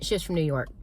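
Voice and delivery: ordinary delivery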